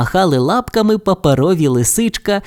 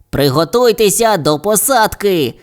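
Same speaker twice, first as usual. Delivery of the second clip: raised-pitch announcing call; higher, eager pilot voice; pilot's exclamation; 6 semitones higher than usual. A narrator's reading. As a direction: call out to the crew as a brave pilot. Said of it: "вигукнув хоробрий пілот-фантазер".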